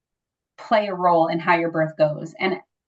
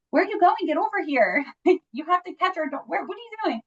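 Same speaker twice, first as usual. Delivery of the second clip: frantic urging; 10 semitones higher than usual. Retold or imitated. Wife urging husband to catch baby.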